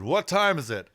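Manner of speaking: startling call to passers-by; sudden loud question. Retold or imitated. Musician sits up and startles two interns, loud.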